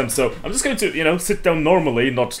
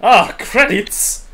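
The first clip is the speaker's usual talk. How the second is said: high, slow delighted exclamation; surprised; 7 semitones higher, much slower than usual. Real, not acted. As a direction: draw it out in surprise. Sees credits mid-game.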